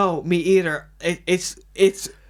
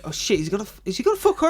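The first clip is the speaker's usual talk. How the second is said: mock shocked gasp; 3 semitones higher, faster than usual. Imitating a viewer sensing romance, mock shocked. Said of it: "you feel like".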